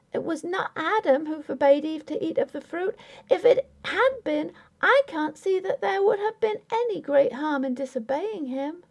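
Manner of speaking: put-on high, pleading young wife voice; young wife Grace. A narrator's reading. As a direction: voice Grace, hurt and insistent. Grace's voice, said quickly.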